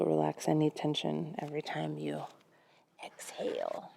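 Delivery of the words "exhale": breathy word let out on a sigh; breathed-out exhale. Shown as a demonstration. Models the exhale while cueing breath.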